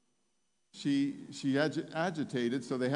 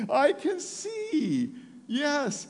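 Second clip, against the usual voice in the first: very high, loud, stretched-out exclamation; retold joyous cry; 8 dB louder, much slower than usual. Preacher voicing the woman seeing again, joyous cry. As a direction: imitate her overjoyed shout, holding the words long.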